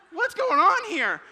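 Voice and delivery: a big mock cry of confusion; hammed-up baffled boyfriend; bewildered outburst. Bewildered by long phone chatter.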